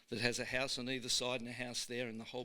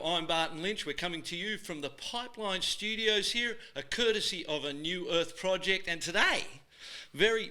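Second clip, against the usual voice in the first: show host intro; 3 semitones higher, 8 dB louder than usual. Acted. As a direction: sell the show like an excited announcer.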